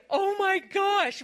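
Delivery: high, slow gasp of amazement; awestruck. Retold or imitated. Acting out daughter seeing the restaurant table, awestruck. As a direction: stretch it out high, as if in awe. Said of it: "We were all in awe".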